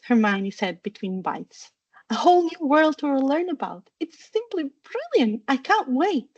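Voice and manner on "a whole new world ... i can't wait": put-on high, swooping, gushing girl; giddy excitement. A narrator's reading. Daughter tells parents about a whole new world, excited. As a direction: gush in a high voice, thrilled.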